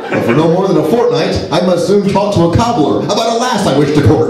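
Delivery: a high, mocking Middle Ages tavern voice; mock old-timey speaker. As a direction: ham it up like a knight from a fantasy tale. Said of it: "Who the hell talks like that?".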